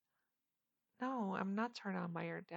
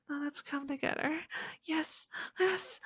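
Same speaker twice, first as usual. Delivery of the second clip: high, loud and pleading; breathless urging; 7 semitones higher, 10 dB louder than usual. Acted. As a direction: push toward the peak. Urging at climax.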